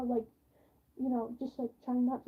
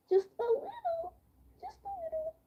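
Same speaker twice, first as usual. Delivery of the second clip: mock whimper; 4 dB quieter than usual. Mock weeping about embarrassment.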